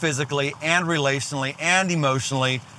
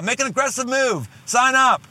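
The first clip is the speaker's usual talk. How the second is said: excited push; 7 semitones higher than usual. Real, not acted. Podcast call to action.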